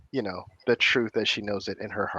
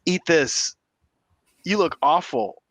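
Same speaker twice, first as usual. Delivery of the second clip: high, loud fretting voice; worried scolding; 11 semitones higher, 5 dB louder than usual. Acted. Tess fusses over the beaten hero, worried scolding. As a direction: scold while handing over fruit.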